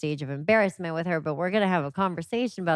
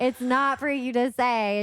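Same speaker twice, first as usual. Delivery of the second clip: higher, prim scolding tone; mock prim scold; 7 semitones higher than usual. Mom retelling how she scolded Gwen, higher and prim. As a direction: correct her in a proper voice.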